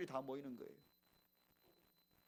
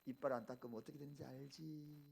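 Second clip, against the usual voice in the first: lower, softer coaxing question; patient mother; 4 semitones lower, 5 dB quieter than usual. Acted. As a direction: gently lead a child to the answer. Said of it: "유대인들은 다릅니다".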